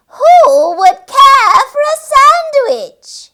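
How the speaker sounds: high, loud, slow sing-song; creature call. A narrator's reading. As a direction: lure the pig in. Creature's call repeated, nearer.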